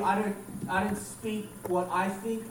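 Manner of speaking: normal talking voice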